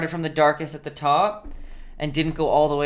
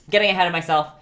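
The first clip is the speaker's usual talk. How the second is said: laughing at herself; 3 semitones higher, 8 dB louder, much faster than usual. Real, not acted. Painter catching herself mid-lesson, laughing louder. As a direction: repeat it louder through a laugh. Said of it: "I'm getting ahead of myself".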